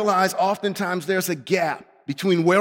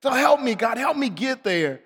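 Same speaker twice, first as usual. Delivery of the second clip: high, urgent frustrated plea; 4 semitones higher than usual. Acted. Preacher admitting the gap to God, frustrated and pleading. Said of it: "I became frustrated with the gap".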